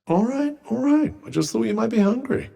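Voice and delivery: high-pitched, hurried-to-appease protest; defensive placating. Acted. Companion backing off after offering food, defensive and high-pitched. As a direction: back off defensively, voice lifted high.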